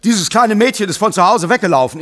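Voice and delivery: "Madame" called out high and loud with a rise; shouting. Acted. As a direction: call "Madame" out to get her attention. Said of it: "schreit Turandot".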